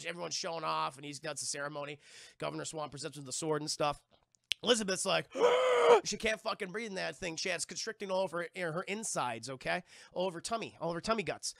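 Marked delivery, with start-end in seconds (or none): 5.3-6.0 loud, high choking gasp; a sharp strangled gasp. Acted. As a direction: gasp for air in the corset. Elizabeth can't breathe.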